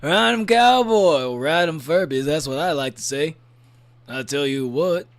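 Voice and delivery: loud, slow cowboy holler. Pretend. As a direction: drag it out like a rodeo call. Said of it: "which is just my cowboy voice".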